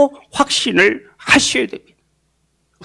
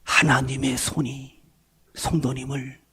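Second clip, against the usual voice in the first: tender assurance; 8 semitones lower, 5 dB quieter than usual. Real, not acted. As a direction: reassure them gently, like a promise.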